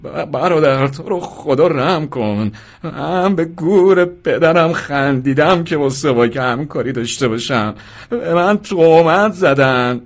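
high, slow, stammering wail; crying plea. A narrator's reading. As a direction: beg through loud, drawn-out sobs. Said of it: "مرد گریه کنان گفت". (التماس با گریه و زاری)

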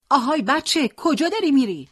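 put-on high, loud hail to a child; calling out. A narrator's reading. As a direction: narrator voicing a woman calling down the street. Old woman calls out to boy.

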